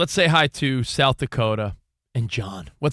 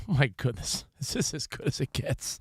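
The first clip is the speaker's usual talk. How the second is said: mock despair; 9 dB quieter than usual.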